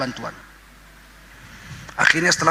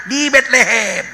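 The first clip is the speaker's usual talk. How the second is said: put-on Batak accent; 6 semitones higher, slower than usual. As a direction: play a Batak man answering, amused, Batak accent. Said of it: "Orang Batak ditanya".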